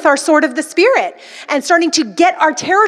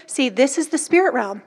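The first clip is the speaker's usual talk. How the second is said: ordinary delivery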